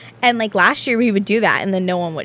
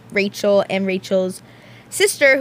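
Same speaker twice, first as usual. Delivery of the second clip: usual speaking voice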